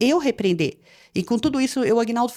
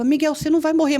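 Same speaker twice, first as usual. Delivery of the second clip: higher-pitched, urgent insisting; frightened reassurance, retold; 6 semitones higher, 3 dB louder than usual. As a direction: reassure a son while scared. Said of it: "eu tava super assustada". (consolo assustado, em voz aguda)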